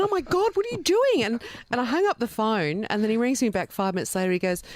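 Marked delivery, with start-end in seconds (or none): 0.0-1.2 reliving the shock of a surprise bid; shocked cry. Retold or imitated. Wife on the phone hearing husband bought a house, shocked high cry. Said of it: "I went".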